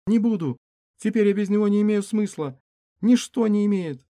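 high tearful woman's vow; high female voice. Acted.

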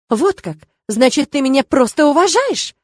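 angry, rising challenge; angry mother. A narrator's reading. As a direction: throw it back at him, offended. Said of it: "мама сейчас очень сильно злится".

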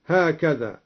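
normal talking voice

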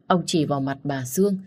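plain reading voice

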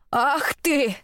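high, drawn-out indignant cry; outraged exclamation. A narrator's reading. Old woman enraged, moves to attack. (гневный возглас старухи, высоко)

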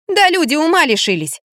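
high-pitched exclamation; exclaiming. A narrator's reading. Woman telling Liza people have gone mad, exclaiming. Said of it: "воскликнула я".

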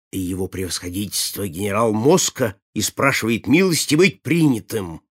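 plain reading voice

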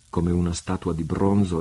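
ordinary delivery, nothing marked

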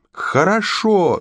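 very high, drawn-out yell at moderate volume; exasperated yell. A narrator's reading. The queen gives in to her friends, yelling. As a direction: stretch one word into a high cry. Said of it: "заорала она".

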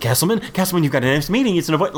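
desperate. Retold or imitated. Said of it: "they are desperate".

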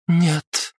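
sharp high flat no; curt refusal. A narrator's reading. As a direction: cut him off with one word. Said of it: "категорично отрезала".

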